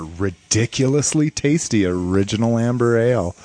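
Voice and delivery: usual speaking voice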